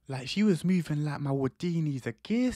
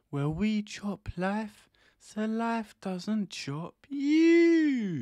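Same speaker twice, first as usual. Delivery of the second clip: rising catchphrase; 6 semitones higher, much slower than usual. Acted. Channel catchphrase intro.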